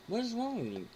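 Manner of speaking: high incredulous rant; outraged. Real, not acted. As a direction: ask it high and scandalized. Mock outrage over food.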